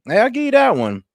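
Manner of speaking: normal talking voice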